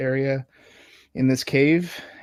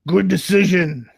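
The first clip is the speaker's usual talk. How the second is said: goblin leader; 4 semitones higher, 6 dB louder than usual. Acted. Goblin leader gloating at the party's surrender. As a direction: gloat over the deal.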